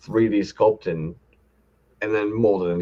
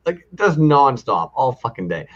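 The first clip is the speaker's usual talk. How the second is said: normal talking voice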